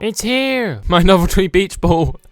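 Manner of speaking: put-on a kid thrilled at his toy; excited kid. Kid character finds ball.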